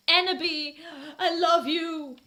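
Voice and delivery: high, loud and slow, crying; hammed-up sad shout. Draco shouting his love to Enderby, sobbing. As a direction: cry it out like a heartbroken goth. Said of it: "he shouted sadly".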